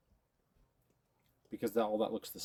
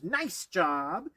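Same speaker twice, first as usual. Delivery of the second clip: praise word; 7 semitones higher, 6 dB louder than usual. Shown as a demonstration. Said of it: "You can use".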